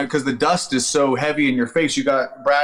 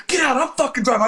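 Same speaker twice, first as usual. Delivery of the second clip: high, yelled order in imitation; retold shouting match; angry commander's order; 12 semitones higher, faster than usual. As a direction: order the driver out, pitched way up.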